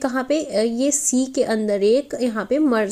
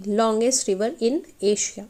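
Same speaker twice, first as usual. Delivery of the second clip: slow dictation; 2 semitones lower, much slower than usual. Shown as a demonstration. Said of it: "teacher repeats fact slowly".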